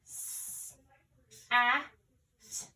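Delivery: "S, A, T" broken into three separate sounds; sounding out letters. Shown as a demonstration. Teacher showing the three sounds of sat, segmented. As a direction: tap out "S, A, T" one by one. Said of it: "the word sat has three sounds".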